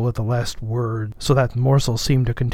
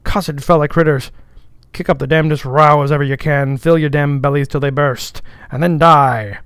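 louder dialect curse on a crowd; raised-voice mock benediction; shrill cry; 4 semitones higher, 8 dB louder than usual. A narrator's reading. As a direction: cry it out over a noisy mob. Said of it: "raised his shrill voice and cried".

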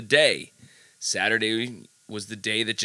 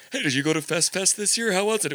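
higher, quicker chirpy fake voice; mock festival-goer; 7 semitones higher, faster than usual. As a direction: play a clueless festival fan. Mock festival-goers chatting.

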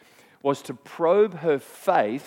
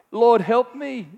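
higher, louder desperate plea; pleading woman; 8 semitones higher, 7 dB louder, slower than usual. Acted. Canaanite mother begging Jesus for her daughter, high and loud. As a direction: cry out to him for help.

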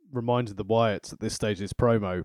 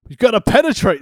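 high and loud, cracking up; loud laughing emphasis; 10 semitones higher, 10 dB louder than usual. Real, not acted. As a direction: push the word penetrate out big and high.

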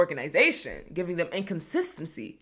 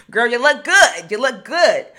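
louder, higher hype-up compliment; 5 semitones higher, 12 dB louder than usual. Acted. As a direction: gas up your sister. Hyping a friend in crowd.

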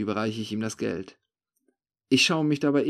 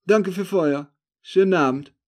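curt thanks pitched up and loud; 7 semitones higher, 8 dB louder than usual. A narrator's reading. The stranger taking leave of the narrator, curt. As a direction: toss off a brusque goodbye. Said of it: "sagt er kurz angebunden".

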